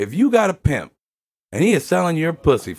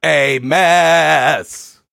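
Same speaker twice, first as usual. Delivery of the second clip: loud, drawn-out exclamation; big emphatic holler, stretched long; all caps; 4 semitones higher, 6 dB louder than usual. Shown as a demonstration. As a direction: belt it out as if in capitals. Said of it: "All caps".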